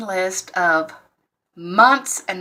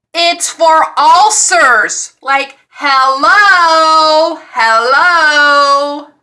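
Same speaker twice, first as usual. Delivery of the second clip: loud, drawn-out exasperated "hello, hello"; sarcastic, incredulous outburst; 7 semitones higher, 8 dB louder, much slower than usual. Real, not acted. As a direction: stretch each "hello" out slowly and loudly in disbelief. Exasperated at wrong medicine.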